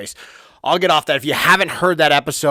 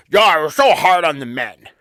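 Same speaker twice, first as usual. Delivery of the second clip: mocking singsong; 3 semitones higher, 5 dB louder than usual. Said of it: "don't sing me the fucking song".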